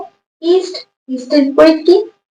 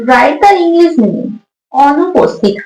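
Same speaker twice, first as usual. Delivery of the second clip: slow dictation; slower than usual. Shown as a demonstration. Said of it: "teacher reads the question aloud".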